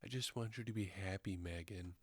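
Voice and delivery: soft, fast, lightly mocking lovesick plea; a hint of a lovesick boy; mock sappy whisper. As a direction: coo the line in parody. Mocking Dan's sappy line.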